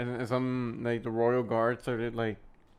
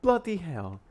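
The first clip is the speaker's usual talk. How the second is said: mock British accent; 7 semitones higher, 3 dB louder than usual. Repeating British quote.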